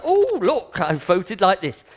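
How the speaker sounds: mock the voter who suddenly finds his vote changed; mock surprised voter. Preacher mocking a silly voter to the congregation, loud mock surprise. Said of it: "and go".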